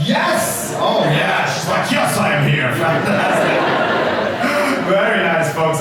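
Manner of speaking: ordinary talk, nothing marked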